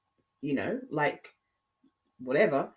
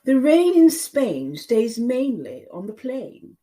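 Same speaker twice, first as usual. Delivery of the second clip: slow, louder Queen's English elocution; 6 semitones higher, 8 dB louder, slower than usual. Shown as a demonstration. Demonstrating Queen's English to a Southern viewer, Queen's English. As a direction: show off a posh Queen's English. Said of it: "I can speak the Queen's English if I wanted to".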